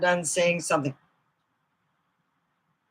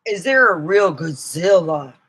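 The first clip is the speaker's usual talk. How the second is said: ordinary talk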